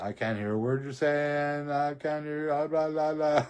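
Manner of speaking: high, loud, drawn-out drowning-out; loud mock not-listening. Talking over the whispering man, loud high mock deafness. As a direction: drown him out with a high childish blah-blah.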